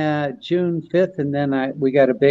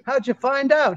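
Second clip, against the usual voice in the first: higher voice for the joke's straight man; retold joke voice; 10 semitones higher than usual. Friends hear Rodney's joke setup, a higher retold voice. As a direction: pitch it up for the setup line. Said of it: "And so he goes to Rodney".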